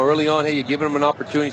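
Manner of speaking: normal talking voice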